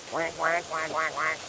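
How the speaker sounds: soft, playful duck quacks; mock quacking. Greeting the geese with soft mock quacks. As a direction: quack softly at the birds.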